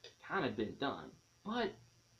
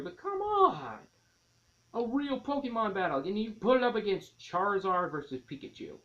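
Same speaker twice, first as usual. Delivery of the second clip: much higher and louder indignant outcry; exasperated rant; 8 semitones higher, 5 dB louder than usual. Real, not acted. Fan complains to viewers about missing battles, indignant outcry. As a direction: let the fan frustration burst out.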